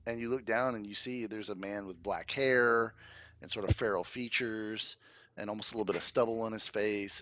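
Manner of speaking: normal talking voice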